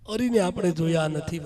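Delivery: normal talking voice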